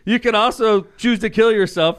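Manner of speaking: high, loud incredulous rant; mock outraged protest; exasperated. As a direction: blurt it out in disbelief. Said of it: "And I'm like".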